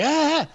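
high-pitched panicked "ah"; retold group scream, loud and high; mock scream. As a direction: imitate friends screaming in fright. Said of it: "everybody starts screaming".

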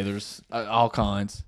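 ordinary talk, nothing marked

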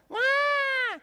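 high loud demon shout; demon shriek. Retold or imitated. Demon interrupting the preacher, shrieking loud. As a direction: screech like a possessed man. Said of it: "he goes".

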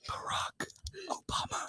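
soft unvoiced whisper; ASMR whisper. Shown as a demonstration. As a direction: breathe the name out. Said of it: "I'm gonna say some trigger words".

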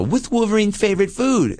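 put-on high, eager kid's question; nerdy kid voice. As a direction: ask it like an excited fan kid.

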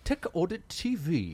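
put-on high, drawn-out British accent. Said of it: "You need a British accent".

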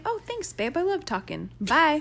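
high, dismissive mock husband. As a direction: brush her off in a high mock voice. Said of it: "You say".

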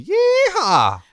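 very high, drawn-out rodeo holler; playful high-pitched cowgirl cry; cowgirl whoop. Acted. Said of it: "she gotta say".

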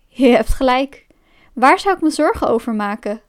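ordinary delivery, nothing marked

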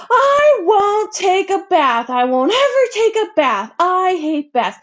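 put-on shrill, high, drawn-out shriek of a furious child; screaming tantrum. A narrator's reading. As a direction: howl like a wild little girl refusing her bath. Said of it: "and yelled".

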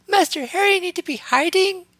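very high alarmed elf voice; startled house elf. A narrator's reading. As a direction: squeak it out in alarm. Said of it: "looking more than a little startled".

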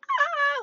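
a short high mock scream, imitating herself; sudden high-pitched scream from the memory; retold scream. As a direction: yelp it out high the way you screamed that night. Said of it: "like, screaming".

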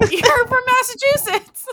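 high, loud squeal through laughter; laughing outburst. Real, not acted. Laughing realization about the co-host.

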